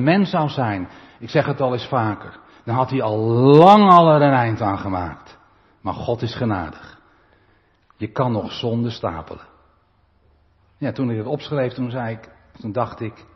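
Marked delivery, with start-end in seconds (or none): none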